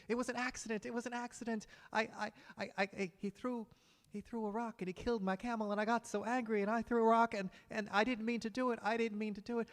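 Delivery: high, stammering, desperate voice begging for his life; a terrified man tripping over his words; panicked pleading camel driver. Acted.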